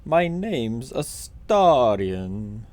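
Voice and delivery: very slow, drawn-out hammed-up impression; deliberately overdone mimicry, dragged right out; Astarion. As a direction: badly imitate a theatrical self-introduction, stretching every word. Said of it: "That was a terrible impression".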